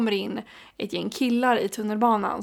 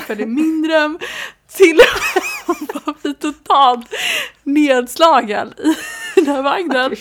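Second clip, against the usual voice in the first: louder, slow, half laughing, half sobbing; laughing and crying; 5 semitones higher, 7 dB louder than usual. Real, not acted. Recalling being shamed in subway car, tearful laughter. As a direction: laugh through the humiliation.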